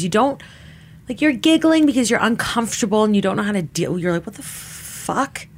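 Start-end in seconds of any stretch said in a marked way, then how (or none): 4.3-5.5 whispered disbelief. Retold or imitated. Retold shocked reaction to grab.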